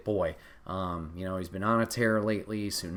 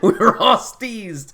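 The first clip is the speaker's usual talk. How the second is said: laughing; 11 semitones higher, 12 dB louder, slower than usual. Real, not acted. Laughing recalling crowd reaction.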